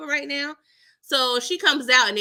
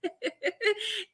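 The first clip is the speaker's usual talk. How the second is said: high laugh; 10 semitones higher, 6 dB quieter than usual. Real, not acted. Laugh alone.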